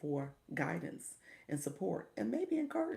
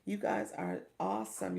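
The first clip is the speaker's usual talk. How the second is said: normal talking voice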